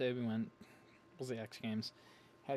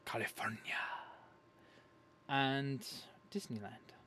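whisper; slower than usual. Shown as a demonstration. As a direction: breathe California as a secret.

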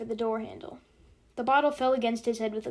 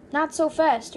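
higher, louder plea to slow down; urging; 5 semitones higher, 7 dB louder, slower than usual. A narrator's reading. Passenger to the speeding driver, urging. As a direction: urge the driver to ease off. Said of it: "urged Nately".

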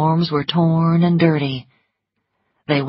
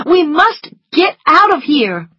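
high, loud urgency; agitated, high-pitched insistence; urgent, frightened plea; 11 semitones higher, 8 dB louder than usual. Acted. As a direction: plead to escape in a raised voice. Scarlet urges escape in fear.

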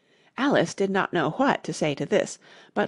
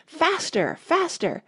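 high, urgent cry to hurry; 9 semitones higher, slower than usual. A narrator's reading. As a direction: cry it out high, urging a runner on. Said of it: "the Queen kept crying".

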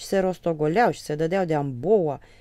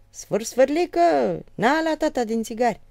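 higher and louder, coaxing call; calling the child; 8 semitones higher, 7 dB louder than usual. Retold or imitated. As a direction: call the little one over, teasing. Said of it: "îl chema pe la micu". (tatăl cheamă copilul)

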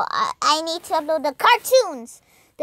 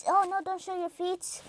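ordinary delivery

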